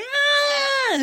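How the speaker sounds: very high mock scream; freaked-out scream. Defendant seeing the axe, mock freaked-out scream. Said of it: "freaking out".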